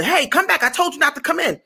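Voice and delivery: high-pitched mock reprimand; scolding security guard. Guard calling after a woman sneaking in, scolding. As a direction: call someone back, acting the guard.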